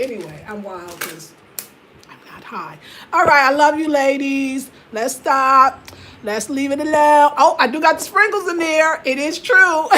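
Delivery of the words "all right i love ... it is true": excited. Real, not acted. Excited live greeting to viewers.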